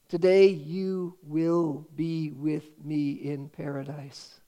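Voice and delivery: very slow, every word weighed; slowed, reverent recitation of the verse; slow solemn quote. Real, not acted. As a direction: savour the promise slowly. The key verse repeated for weight.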